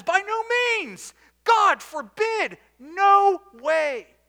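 high, loud, slow preacher's refusal; emphatic denial. Acted. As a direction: thunder it out as a flat no. Paul's emphatic rejection, preaching.

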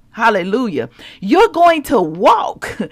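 ordinary talk, nothing marked

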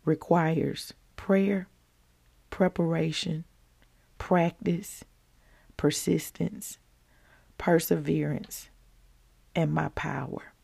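each word slow and soft, with pauses; slow quiet list. Shown as a demonstration. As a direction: lay the list down slowly, hushed. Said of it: "Everything I have called you for".

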